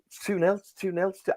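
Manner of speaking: high excited repeat of the score; excited shouting. Retold or imitated. Fan shouting the score to his wife, high and excited. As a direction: blurt out the score in joy. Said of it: "I was like".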